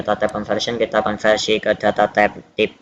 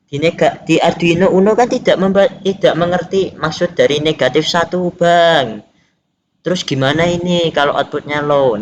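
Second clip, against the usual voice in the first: raised pitch, putting a learner's objection; questioning learner; 6 semitones higher than usual. Acted. Learner asks Bang about negative one on Arduino Uno, higher questioning voice. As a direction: ask it higher, like a student querying the tutor.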